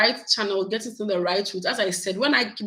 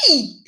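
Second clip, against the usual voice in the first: delighted squeal; 5 semitones higher, 7 dB louder than usual. Real, not acted. Overwhelmed at being recognized.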